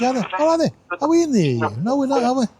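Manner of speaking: ordinary talk, nothing marked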